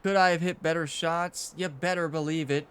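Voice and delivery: usual speaking voice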